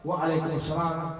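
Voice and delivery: soft, slow reply under the breath; answered softly. A narrator's reading. The family answers the Prophet's salam very softly. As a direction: answer quietly so he won't hear. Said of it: "tapi pelan-pelan".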